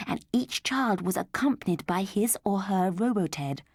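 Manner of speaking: ordinary delivery, nothing marked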